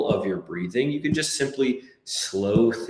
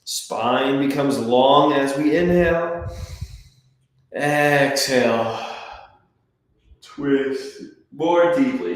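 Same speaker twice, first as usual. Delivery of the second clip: slow stretched cue; 4 semitones higher, 3 dB louder, slower than usual. Shown as a demonstration. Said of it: "cue Exhale".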